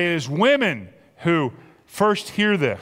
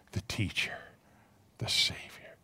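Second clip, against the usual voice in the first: whispered, much softer; awed whisper; 10 semitones lower, 14 dB quieter than usual. Acted. Preacher voices Mary recognizing Jesus, whispered. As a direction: breathe the words in awe.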